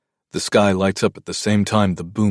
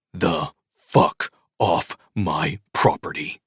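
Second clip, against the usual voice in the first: slow, through clenched teeth; much slower than usual. Acted. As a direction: force it out through gritted teeth. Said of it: "I say with my teeth clenched".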